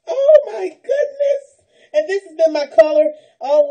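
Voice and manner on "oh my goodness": high, slow, laughing tearful cry; tearful delight. Real, not acted. Finding her favorite color, tearful delight. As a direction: let it wobble with joy.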